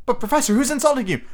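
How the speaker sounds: timid, high, quick student voice; Parvati Patil. A narrator's reading. Student questioning distraught teacher, timid high voice. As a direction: ask timidly in a high girlish register. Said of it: "asked Parvati timidly".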